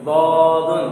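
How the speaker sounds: "दादुन" chanted with a heavy D and rounded first vowel; thick D. Shown as a demonstration. Teacher showing this letter too is thick, heavy chanted "दादुन". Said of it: "जबान का बायां किनारा".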